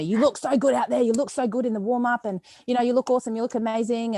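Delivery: imitating the coach cheering her on before the lift; enthusiastic praise. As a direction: pump her up like a hyped coach.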